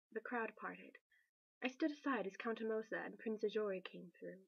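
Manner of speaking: plain reading voice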